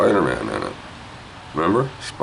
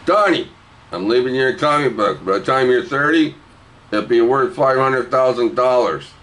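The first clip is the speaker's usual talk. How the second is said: raised-pitch announcement to a grandchild; acted grandparent, pitched up; grandparent addressing grandchild; 5 semitones higher than usual. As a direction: call the name high, then proudly promise. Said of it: "You say to your old grandchild".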